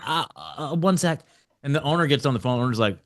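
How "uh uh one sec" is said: higher, hesitant little reply; mock meek stammer; flustered compliance. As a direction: imitate a rattled receptionist stalling. Flustered receptionist gives in.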